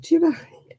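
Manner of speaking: high-pitched retold reproach; mock-outraged question, high; indignant challenge. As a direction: challenge her, pitched right up. Retold confrontation with swearing mother.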